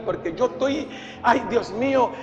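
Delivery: normal talking voice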